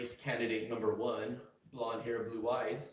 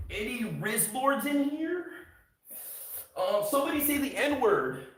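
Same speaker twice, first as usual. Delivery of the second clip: much higher, loud, slow obnoxious yelling; annoying troll viewer; 13 semitones higher, 8 dB louder than usual. Retold or imitated. Streamer mocks an unfunny troll bursting into chat, loud and shrill. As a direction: ham up a cringe chat troll. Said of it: "that fucking loser that comes in like this".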